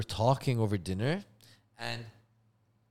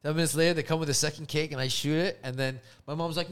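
normal talking voice

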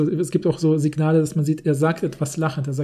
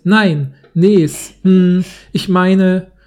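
loud protest; 7 dB louder, much slower than usual. Pretend. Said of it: "in der Art vorzulesen".

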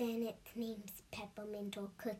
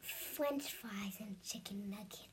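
soft whispered answer; whispering; 4 semitones lower, 9 dB quieter than usual. Real, not acted. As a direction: whisper it, barely audible. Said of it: "Speak louder, please".

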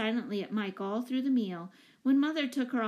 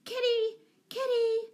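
high shrieking calls; 4 dB louder, slower than usual. A narrator's reading. Jane shrieking for the lost cat in the storm, high calls. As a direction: shriek the name into the gale.

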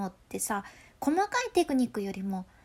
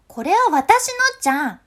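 much higher and much louder, stabbing at the listener; piercing loud voice; 8 semitones higher, 10 dB louder than usual. Shown as a demonstration. Claiming the pudding from family, piercing loud jab. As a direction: jab the words straight at them, hard. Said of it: "突き刺す感じの声".

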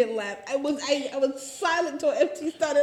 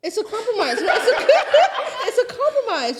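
laughing; 10 semitones higher, 8 dB louder than usual. Real, not acted. Laughing through the line.

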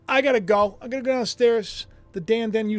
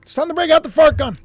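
higher, fast, louder repeat of the alert; echoing the alert; 8 semitones higher, 8 dB louder, faster than usual. Retold or imitated. Streamer repeating the alert to viewers, lightly higher. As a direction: parrot the squeaky alert back. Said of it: "this is an alert".

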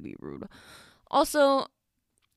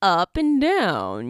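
slow swoop up and down in pitch; exaggerated seesaw pitch; voice going up and down; 3 dB louder, slower than usual. Shown as a demonstration. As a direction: let the pitch rise and plunge as you draw it out. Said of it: "my voice tends to, like, go up and down".

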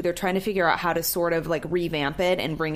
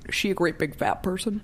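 creepy whispery killer voice; lightly voiced serial killer; Buffalo Bill voice. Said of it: "I sound just like Buffalo Bill".

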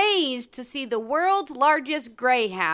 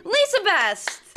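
high, drawn-out name call; fighting game announcer; 9 semitones higher, 3 dB louder, slower than usual. Pretend. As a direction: shout the name like a ring announcer. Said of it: "I like your announcer voice".

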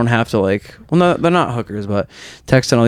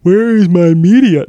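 higher, louder, slow frustrated cry; exasperated call out; 8 semitones higher, 6 dB louder than usual. Real, not acted. Searching his phone for the tweet, exasperated loud cry. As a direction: drag it out at the screen.

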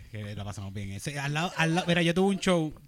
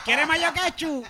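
mock waiter; 11 semitones higher, 5 dB louder, slower than usual. Imitating waiter.

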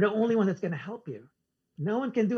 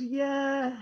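drawn-out, raised-pitch mock reluctant yeah; holding back a "but"; 5 semitones higher than usual. Rehab man biting back his but, imitated hesitant yeah. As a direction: imitate him swallowing his objection. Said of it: "he didn't say it".

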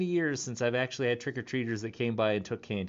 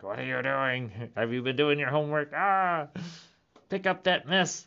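nagging grandparent; 3 semitones higher than usual. Retold or imitated. Grandparent ghost nagging about homework, mock scolding. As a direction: play a fussing grandma checking up. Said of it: "They're like".

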